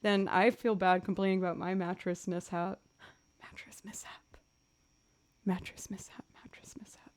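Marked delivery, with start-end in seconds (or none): 3.4-7.1 playful whisper. Real, not acted. Streamer relishing her own phrase, whispered. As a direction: gasp then whisper the phrase.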